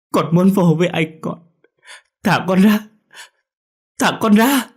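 high, loud tearful wail, building up; a boy howling to be let go; screaming and crying. A narrator's reading. Boy screams to see his brother, sobbing. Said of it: "Nó kêu gào khóc lóc".

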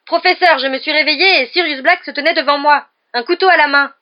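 high, loud urgent account; panicked pleading. A narrator's reading. Ron tells the professor about the intruder, panicked. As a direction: shout to be believed.

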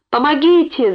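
high, urgent mother's scream, narrated; cry for help. A narrator's reading. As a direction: call out desperately for help. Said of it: "закричала Александра Сергеевна". (крик о помощи)

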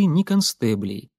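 ordinary delivery

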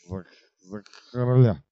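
slurred, stumbling toast; drunk stammer. Acted. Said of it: "повторил Стабс". (пьяно, запинаясь)

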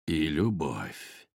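put-on low, slow, soft old wizard; deep Father Frost voice. A narrator's reading. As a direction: breathe it out like an ancient sage. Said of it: "проговорил Дед Мороз". (низкий, медленный голос Деда Мороза)

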